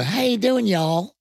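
high, Southern greeting; put-on high Southern hello; Southern accent. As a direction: hail them like a Southerner. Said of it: "they go".

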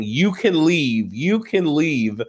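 slow, drawn-out mock yell, no real volume; mildly hammed-up retold yell. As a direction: imitate a drunken yell, stretching each word. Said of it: "She's yelling at Angie K".

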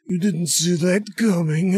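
slow, put-on dying voice; mock last words. Podcaster mocking a hero's final line, hammed-up last words. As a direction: drag it out like a hammed-up dying man. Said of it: "for your last words to be like".

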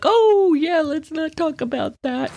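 cringe and change the subject; awkward deflection. Retold or imitated. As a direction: uncomfortable child dodging the topic. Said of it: "I'm like".

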